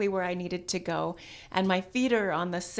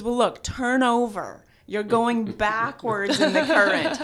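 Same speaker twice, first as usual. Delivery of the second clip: laughing through the quote; 3 semitones higher, 6 dB louder than usual. Retold or imitated. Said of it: "And he says".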